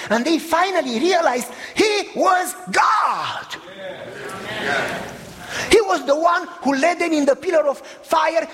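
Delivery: rising, urgent preacher's cry; preaching at full force. Acted. Preacher telling the congregation who Jesus was, in full force. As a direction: proclaim it to the whole room.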